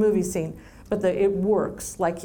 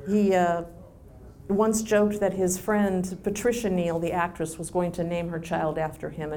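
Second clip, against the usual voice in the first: ordinary talk, nothing marked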